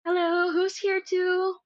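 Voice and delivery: calling out high and loud; loud high greeting. Real, not acted. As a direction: call out a big hello. Greeting viewers, calling out loudly.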